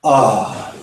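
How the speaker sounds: pushed-out "ah"; forced "ah". Shown as a demonstration. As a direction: force the sigh out instead of releasing it. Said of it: "here is what forcing it looks like".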